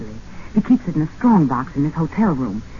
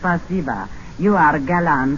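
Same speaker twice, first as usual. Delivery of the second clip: fake Russian accent; 3 semitones lower than usual. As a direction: play the Russian lady. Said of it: "What happened to your Russian accent?".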